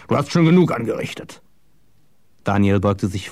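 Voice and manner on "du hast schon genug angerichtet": growled rebuke, higher than narration. A narrator's reading. Johann silencing Daniel, growling. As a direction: growl the order to silence him. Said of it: "knurrte Johann".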